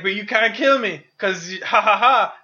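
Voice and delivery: smug Macbeth laughing off the threat; taunting villain. Retold or imitated. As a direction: gloat like a cocky villain who thinks he can't die. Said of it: "he's like".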